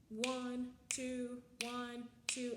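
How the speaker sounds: one, two counted evenly in twos; counting duple meter. Shown as a demonstration. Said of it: "the duple meter".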